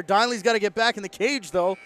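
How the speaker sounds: real urgency in live play-by-play; urgent play call. Real, not acted. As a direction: call out the danger as it unfolds. Goalie caught out of cage.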